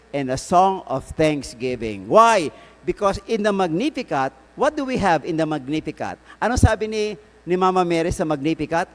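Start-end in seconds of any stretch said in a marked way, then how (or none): none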